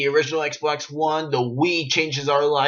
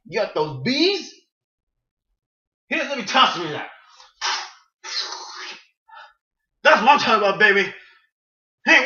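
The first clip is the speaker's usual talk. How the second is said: higher and louder swaggering street-gangster voice; 8 semitones higher, 4 dB louder than usual. Retold or imitated. Vlogger voicing Trevor as a loud high gangster. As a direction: strut through it like a cocky gangster. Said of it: "I give Trevor Phillips a gangster voice".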